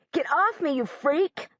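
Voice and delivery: high, angry yell; boy yells at girl standing on his toes. Acted. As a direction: shove her off with your voice. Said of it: "he yells".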